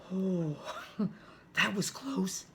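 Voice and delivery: plain reading voice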